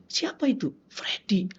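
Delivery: near-whispered question and answer; hushed asking. Retold or imitated. Ustadz and prison guard quietly identify a weeping inmate, hushed.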